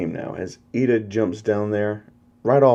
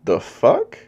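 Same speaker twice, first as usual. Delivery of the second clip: high, sharply rising question; incredulous; 6 semitones higher, 4 dB louder than usual. Acted. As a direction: toss it out in acted disbelief, voice shooting up. Deku reacts to being insulted.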